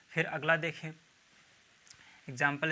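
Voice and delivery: ordinary talk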